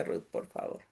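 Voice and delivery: quick whispered request, not for the audience; whispered aside. Real, not acted. Asking Ruth for the next slide, whispered. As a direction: whisper it to the helper off-mic.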